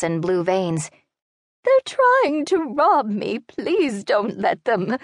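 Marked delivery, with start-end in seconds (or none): 1.6-5.0 put-on high, slow, desperate elderly voice; an old lady begging not to be robbed; pleading old woman. A narrator's reading.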